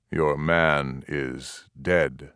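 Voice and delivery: low, slow chief's voice breaking the news; lightly voiced stern chief, slow and low; Cochise, grave. A narrator's reading. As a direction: weigh each word, dropping to a low grave tone.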